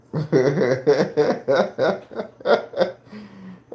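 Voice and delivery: long falling cackle; loud laugh. Real, not acted. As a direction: let out a big laugh. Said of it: "if you ever hear me just yell".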